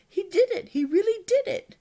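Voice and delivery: high, loud amazed shouting; excited. Acted. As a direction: burst out in disbelief. Hiccup amazed dragon fished.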